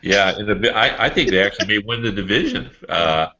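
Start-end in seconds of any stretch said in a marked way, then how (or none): none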